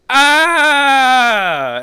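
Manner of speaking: loud, high drawn-out "Ah"; long wail of horror, played for laughs; mock scream. Acting out a robot discovering he's a Terminator, mock scream. Retold scream of Marcus's crisis.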